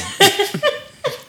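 high-pitched real laugh; burst of laughter. Real, not acted. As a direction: crack up at your own story. Laughs after crack cocaine punchline.

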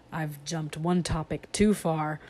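ordinary delivery

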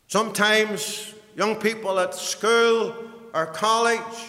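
high, ringing pulpit proclamation; full-force preaching. Real, not acted. Preacher urging believers to follow without turning back, impassioned. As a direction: preach it out with rising fervour.

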